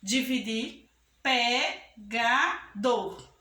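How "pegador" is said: "pegador" very slow, in three beats: pe, ga, dor; teacher dividing "pegador" syllable by syllable; split into syllables. Shown as a demonstration. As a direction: break "pegador" apart with a pause after each syllable. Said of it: "vamos dividir".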